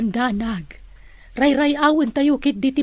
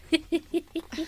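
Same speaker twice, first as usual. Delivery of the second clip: light laugh; 5 semitones higher, 8 dB quieter than usual. Acted. Aunt laughs at Diana.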